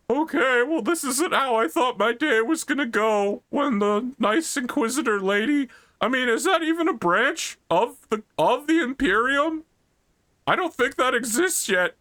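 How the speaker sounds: put-on high, awkward teenage boy voice; Horus. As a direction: play a confused young teen, high-voiced. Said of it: "it's just young Horus, just like".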